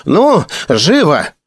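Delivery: put-on slow, high old man's call; old man hurrying them along; hoarse shout. A narrator's reading. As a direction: call out impatiently, stretching the words high. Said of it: "крикнул старик сиплым голосом".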